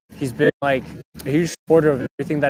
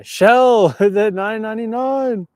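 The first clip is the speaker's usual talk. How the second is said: loud, laughing, high shout-out; excited shout; 9 semitones higher, 6 dB louder, much slower than usual. Real, not acted. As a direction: cheer the donor's name with a laugh. Thanking a donor, laughing.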